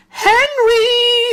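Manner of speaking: very high, loud summons; piercing nurse's call. A narrator's reading. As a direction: sing out the patient's name. Nurse calls patient from waiting room.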